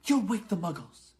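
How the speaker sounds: McGonagall scolding. A narrator's reading. McGonagall warns Hagrid he'll wake the Muggles. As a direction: tell him off sharply. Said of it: "hissed Professor McGonagall".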